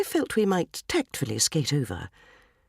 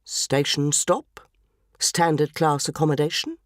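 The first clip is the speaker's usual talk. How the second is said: train announcer parody; 4 semitones lower than usual. Retold or imitated. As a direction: intone it like a bored train manager on the tannoy. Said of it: "he began".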